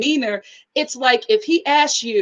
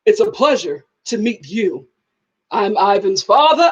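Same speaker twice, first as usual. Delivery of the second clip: imitating a measured, lower stately voice; distinguished deep-voiced father; 4 semitones lower than usual. Dad introducing himself to a guest, mock distinguished voice. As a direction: introduce yourself like a film narrator. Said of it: "very beautiful and distinctive voice".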